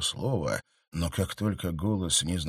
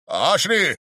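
loud, high cry of "Ашри"; shout; 11 semitones higher, 5 dB louder than usual. A narrator's reading. Count remembers the girl, loud alarmed shout. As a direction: call out her name in alarm. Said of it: "закричал он".

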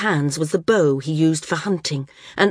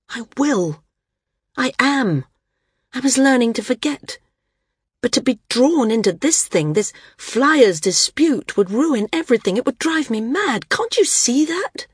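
Maris pleading, voice pitched much higher; a wife near breaking, higher and pleading; distraught Maris; 7 semitones higher than usual. A narrator's reading. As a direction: push her rising panic up to the last line.

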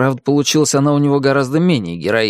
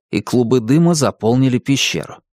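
ordinary delivery

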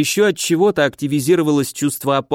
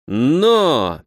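ordinary delivery

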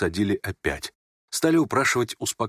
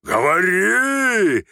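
high, long-drawn frenzied bellow; roaring; 9 semitones higher, 5 dB louder, much slower than usual. Acted. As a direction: roar the order, stretching the last vowel. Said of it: "ревел генерал в совершенном выступлении". (исступлённый протяжный рёв)

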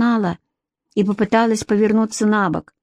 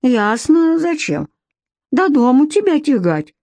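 higher, slow folksy granny; old village woman; 6 semitones higher than usual. A narrator's reading. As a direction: drawl it like a rural babushka.

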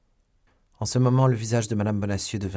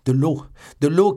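high, loud desperate cry; shouting; 7 semitones higher, 10 dB louder than usual. A narrator's reading. Young man calling for water, desperate shout. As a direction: scream for water. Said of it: "criait D'Artagnan".

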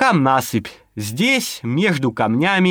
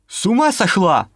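sharp angry outburst, higher; cursing; 5 semitones higher than usual. Acted. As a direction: snap it out in anger. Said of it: "выругался сержант".

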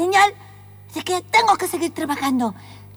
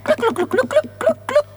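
hen clucking; 7 semitones higher than usual. Pretend.

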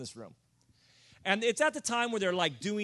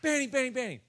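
much higher, lightly voiced eager kid; 6 semitones higher, faster than usual. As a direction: call his name like an excited kid. Eager kid calling again.

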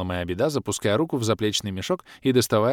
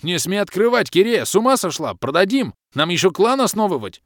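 loud outburst; 10 semitones higher, 6 dB louder than usual. A narrator's reading. Bom yelling at Kireya.

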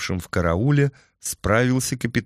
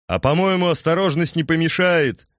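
raised, higher voice over hoofbeats; shouting over wind; 8 semitones higher than usual. A narrator's reading. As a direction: call out above the wind. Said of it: "перекрикивая встречный ветер и грохот копыт".

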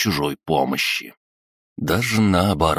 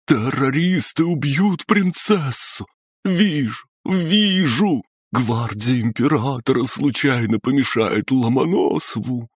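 otherworldly howl; 9 semitones higher than usual. A narrator's reading. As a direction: wail it like a possessed seer. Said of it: "завыла потусторонним голосом".

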